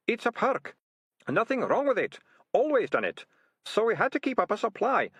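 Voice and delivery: high-pitched, wheedling self-justification; whining, defensive ratcatcher. Acted. Trapped ratcatcher justifying himself to his captors, whining. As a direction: plead your case in a high whine.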